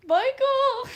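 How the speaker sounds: high, loud call of a name. Real, not acted. Calling the chat mod by name in a loud high yell. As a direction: yell for Michael in alarm.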